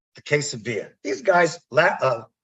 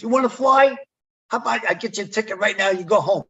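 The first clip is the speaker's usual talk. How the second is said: sarcastic boss retort; 8 semitones higher than usual. Retold or imitated. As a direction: throw the offer back at him, needling. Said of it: "He goes".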